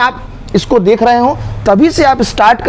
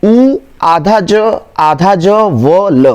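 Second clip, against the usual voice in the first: spelling out; 2 semitones lower, much slower than usual. Shown as a demonstration. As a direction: dictate each letter piece with pauses. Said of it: "दोनों ज आधा होते हैं". (अक्षर-अक्षर करके धीरे बोलें)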